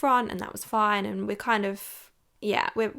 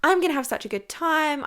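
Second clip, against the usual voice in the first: high, bright gush of mock excitement, louder; over-enthusiastic; 7 semitones higher, 4 dB louder than usual. Friend recalls gushing before her date, over-enthusiastic. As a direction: play up the date-night enthusiasm. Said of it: "over-enthusiastic".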